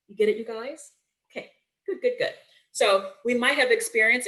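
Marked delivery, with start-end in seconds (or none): 0.1-2.3 sing-song, pitched higher, talking down; kindergarten teacher speak. Shown as a demonstration. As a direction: coax a roomful of little kids. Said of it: "kindergarten, uh, teacher speak".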